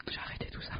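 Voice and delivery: secretive whisper. Pretend. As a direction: breathe it out as a joke confession. Whispered mock confession.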